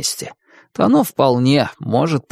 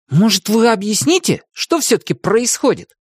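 demanding; 10 semitones higher than usual. Acted. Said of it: "требовательно спросил Тим".

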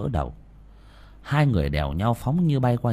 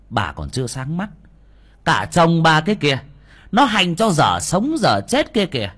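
loud, higher, escalating rebuke; angry scolding; 6 semitones higher, 6 dB louder than usual. A narrator's reading. Young master scolds the woman whose greed endangered her family, furious. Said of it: "mà mắng".

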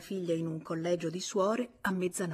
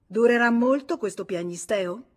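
loud, raised, exasperated question; impatient; 4 semitones higher, 8 dB louder than usual. A narrator's reading. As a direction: demand it with cold impatience, voice up. Impatient with her sobbing. (domanda spazientita, a voce alta)

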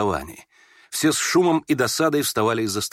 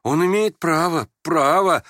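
lost, flustered mumbling; 7 semitones higher, 5 dB louder, slower than usual. A narrator's reading. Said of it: "бормотал Бурдовский, ... совсем как потерянный".

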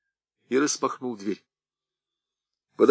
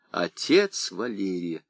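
ordinary delivery, nothing marked

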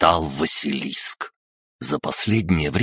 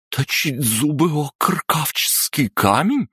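high, incredulous cry; shocked disbelief; 10 semitones higher than usual. Acted. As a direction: voice jumps up in disbelief. Disbelief rising to alarm.

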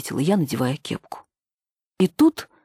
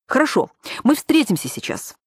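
higher-pitched, decisive agreement; resolute; 8 semitones higher than usual. A narrator's reading. Nina agrees to meet the caller now, resolute. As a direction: settle it firmly, pitch up. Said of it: "решительно сказала я".

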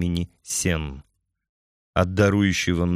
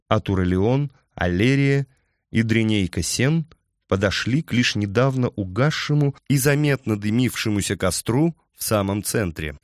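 usual speaking voice

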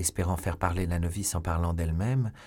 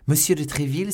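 high, louder exclamation; astonished cry; 7 semitones higher, 5 dB louder than usual. A narrator's reading. Novice hears Milady knows Tréville, astonished cry. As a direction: cry out the name in surprise. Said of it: "s'écria la novice".

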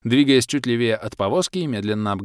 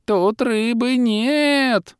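high, very slow squealing creature wail; wild squeal; 13 semitones higher, 3 dB louder, much slower than usual. A narrator's reading. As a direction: squeal it like a panicked little creature. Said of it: "дико визжащий". (дикий визг лохра, высоко и протяжно)

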